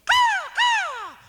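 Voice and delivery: very high, loud crow squawk; piercing falsetto caws. Acted. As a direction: screech it out like a crow. Crow's cry inside children's song.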